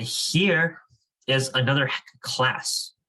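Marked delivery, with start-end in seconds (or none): none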